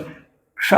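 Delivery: क्ष said softly as a single sound, k fused into sh; pronouncing ksha. Shown as a demonstration. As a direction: model the sound क्ष slowly. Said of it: "इसका उच्चारण भी ध्यान में रखेंगे".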